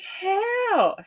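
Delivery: high, baffled wail; incredulous. Retold or imitated. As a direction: exclaim it high in mock disbelief. Retold disbelief at survey result.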